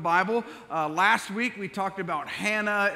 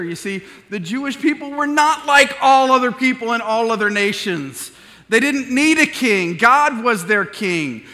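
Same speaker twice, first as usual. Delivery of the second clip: loud, higher, fervent preaching; full-force preaching; 3 semitones higher, 10 dB louder than usual. Acted. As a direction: preach it out with passion.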